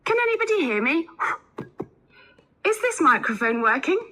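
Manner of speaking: high-pitched, quick mic check; quick, high call to an audience. Acted. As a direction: call to the room to see if they can hear. Lecturer checking the microphone works.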